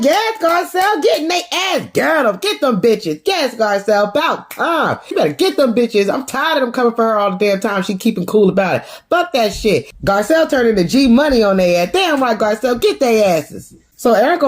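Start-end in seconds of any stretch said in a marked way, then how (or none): 0.0-9.9 high, hyped-up cheer that gradually settles; root for her like at a fight; gleeful cheering. Real, not acted. Cheering Garcelle's comeback.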